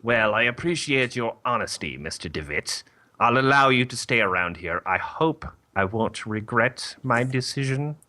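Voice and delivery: formal mother superior granting leave with reservations; nun voice. Acted. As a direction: relent like a strict, measured nun. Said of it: "when I thought nun voice".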